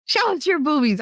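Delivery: high-pitched mock demand; imitating cheeky friend. Male friend pestering her on a video call, imitated cheeky demand.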